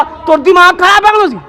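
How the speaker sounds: high-pitched mock scolding; retold angry challenge. Villagers confront the muezzin over the azaan, retold angry challenge. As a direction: imitate an irate villager having a go. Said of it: "तो मुअज्जिन से लड़ने आ गए".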